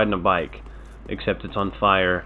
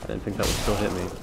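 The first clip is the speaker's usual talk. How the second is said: raging gamer; 3 semitones higher, 4 dB louder than usual. Real, not acted. Heated fight taunts, strain.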